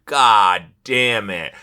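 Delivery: normal talking voice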